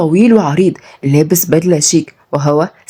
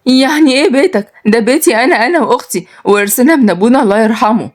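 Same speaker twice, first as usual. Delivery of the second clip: put-on higher voice, protesting young woman; defiant niece, indignant; 5 semitones higher than usual. Niece claims inherited house from uncle, indignant. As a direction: stand your ground, pitched up.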